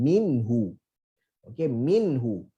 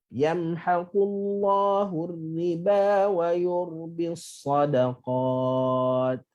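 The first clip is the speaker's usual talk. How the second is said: slow chanted recitation; Quran recitation; much slower than usual. Shown as a demonstration. Teacher recites the verse to show correct qulna. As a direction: recite it slowly, measured and melodic.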